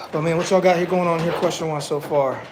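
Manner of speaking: ordinary delivery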